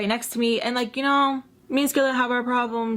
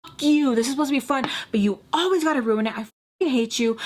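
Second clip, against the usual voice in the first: higher-pitched angry outburst, easing off; retold mad rant; 5 semitones higher, faster than usual. Texting missing Sam in frustration, retold mad. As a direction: tell someone off, hammed-up. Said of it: "And then I'm mad".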